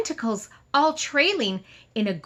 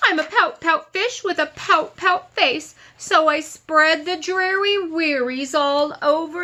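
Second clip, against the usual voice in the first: louder, higher dramatic wail of the refrain; hammed-up gloomy fish; 6 semitones higher, 5 dB louder than usual. Teacher hams up the pouty fish's lament for the kids. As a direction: ham up the gloom, blubs like a mock sob.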